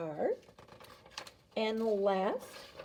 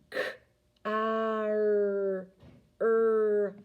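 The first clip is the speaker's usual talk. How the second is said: over enunciating; 3 dB louder than usual. Shown as a demonstration. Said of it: "stretch out car".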